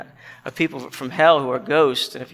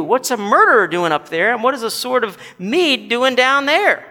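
higher, hurried, mounting protest; outraged ghost; 5 semitones higher, 5 dB louder than usual. Acted. Moral ghost challenges a murderer placed above him, outraged. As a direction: build a self-righteous complaint in a raised voice.

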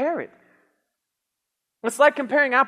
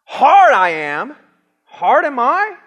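mock inverted speech; 4 semitones higher, 9 dB louder, slower than usual. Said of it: "That's how it actually says there".

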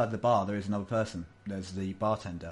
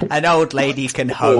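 loud, higher-pitched mock-indignant old lady; humorous old lady; 5 semitones higher, 13 dB louder than usual. Old lady answering doubts she'll survive the ride, loudly humorous. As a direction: belt out a playful retort. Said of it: "she's getting a bit of humour now".

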